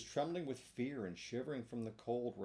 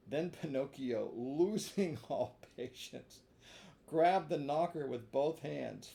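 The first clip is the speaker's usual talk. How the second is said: usual speaking voice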